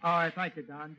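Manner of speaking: ordinary delivery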